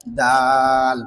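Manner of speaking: দাল with a long held vowel; drawn-out dental দাল; demonstrating articulation. Shown as a demonstration. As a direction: stretch দাল, tongue tip at upper tooth roots. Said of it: "জিহ্বার আগা সামনের উপরে".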